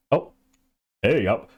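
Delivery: ordinary talk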